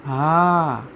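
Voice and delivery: હા held long so the trailing aa rings out; drawn-out syllable demo. Shown as a demonstration. Teacher revealing the vowel inside, હા drawn out. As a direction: stretch હા on a level note, exposing its vowel. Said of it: "આ બોલાણો".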